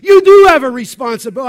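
loud, fast, forceful correction; emphatic rebuke. Acted. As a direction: push back hard at full volume. Preacher rebukes the reluctant student.

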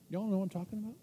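ordinary talk

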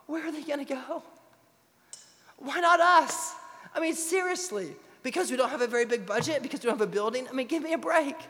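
high and loud, preaching at full force; pregação inflamada. Acted. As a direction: drive it home like a fired-up preacher.

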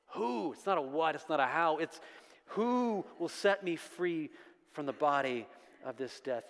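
ordinary talk

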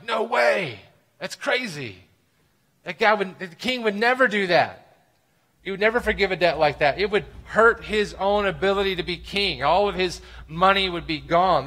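loud, high, disbelieving protest; incredulous crowd outcry. Retold or imitated. Crowd hearing the story protests, loud and incredulous. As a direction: act out the crowd's shocked disbelief. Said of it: "Everybody hearing this would have been like".

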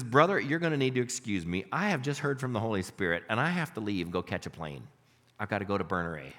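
low and soft, urgent confiding; softly urgent retold words; hushed urgency. Preacher voicing Duncan leaving urgently.

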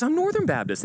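high-pitched, eager reply; giddy, hammed-up high answer; excited answer. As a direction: blurt it with rising hope. Joke: second man answers excitedly.

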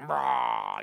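low roaring cheer; playful roar of approval; mock roar. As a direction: growl out a triumphant roar.

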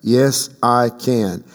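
slow, drawn out, Yes I Can; slow affirming chant; slow call and response. Shown as a demonstration. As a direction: lead the congregation slowly. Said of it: "Say it again".

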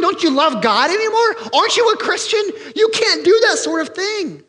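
high, loud, scandalized scolding; outraged accusers. Acted. Critics in Jerusalem scolding Peter, outraged and shrill. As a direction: shriek it at Peter as an indignant critic.

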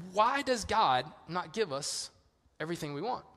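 normal talking voice

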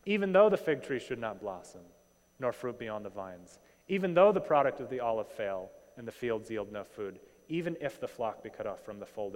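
loud openings on each verse, settling at line ends; declaimed Bible passage; emphatic scripture reading. Acted. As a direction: proclaim the verses with rising conviction. Reads the scripture out to congregation.

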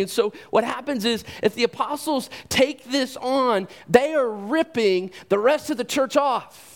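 high, impassioned sermon build; preacher rising to a passionate peak; preaching at full force. Acted. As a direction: drive the point home from the pulpit. Preaching climax.

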